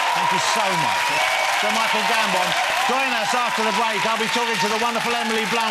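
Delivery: loud, high, upbeat presenter read; radio host sign-off. Acted. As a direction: hype the audience into the break. Host wraps up to the break.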